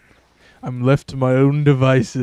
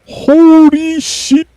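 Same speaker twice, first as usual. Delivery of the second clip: slow, drawn-out high "holy shit", much louder; shocked exclamation; 6 dB louder, much slower than usual. Real, not acted. Gamer shocked by the screen, drawn-out loud exclamation. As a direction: stretch it out in disbelief. Sudden shock mid-game.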